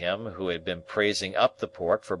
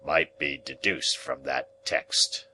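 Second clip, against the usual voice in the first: lower, solemn churchman voice; lightly voiced churchman; 5 semitones lower, slower than usual. Churchman agreeing at the dinner table, low and solemn. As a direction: pronounce it like a pulpit moral. Wopsle continues.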